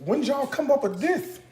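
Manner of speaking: high-pitched mock wonder; acted amazement. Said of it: "You'd be like".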